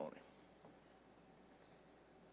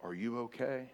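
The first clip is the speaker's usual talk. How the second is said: forgiving friend checking on you, soft; gentle friend; 3 semitones lower, 5 dB quieter than usual. Retold or imitated. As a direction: lower and soft concern. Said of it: "but he said".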